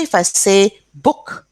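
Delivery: usual speaking voice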